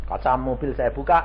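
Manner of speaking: ordinary delivery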